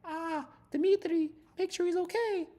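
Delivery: put-on young Russian woman. Russian girlfriend urging Dmitry to check on the swimmer, high mock voice. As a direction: put on a high, drawn-out lady's voice. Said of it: "Their young Russian chicks were like".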